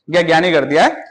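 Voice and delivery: ordinary talk, nothing marked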